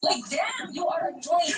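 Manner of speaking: real irritated shout on damn; angry outburst. Real, not acted. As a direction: blow up at him, fed up. Real argument, she snaps at him.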